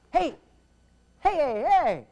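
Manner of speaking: loud, high repeated hey; mock an audience member hailing the stage; calling for attention. Man in the audience to speaker, calling out.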